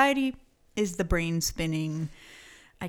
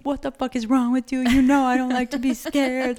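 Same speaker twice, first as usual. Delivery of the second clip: loud, high furious yelling; angry yell; 6 semitones higher, 9 dB louder than usual. Retold or imitated. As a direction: yell at him, outraged. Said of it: "I was like".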